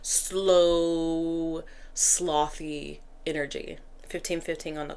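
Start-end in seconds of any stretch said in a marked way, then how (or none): 0.0-3.8 very slow, stretched vowels; slow, lazily lengthened delivery; drawn-out, sluggish. Real, not acted. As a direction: drag the words out like a sloth. Drags words describing sluggish energy.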